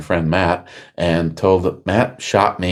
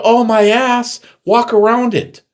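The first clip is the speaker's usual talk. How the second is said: much higher and louder retold rant; telling off the insurance adjuster, loud and high; indignant outburst; 14 semitones higher, 4 dB louder than usual. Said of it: "And I go".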